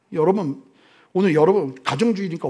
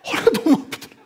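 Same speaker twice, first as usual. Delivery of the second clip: laughing; 8 semitones higher, faster than usual. Real, not acted. Laughing repeat of punchline.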